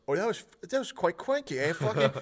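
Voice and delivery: high-pitched silly British voice; exaggerated high British impression; mock British accent. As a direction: imitate a Brit, pitched well up. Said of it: "And then he goes".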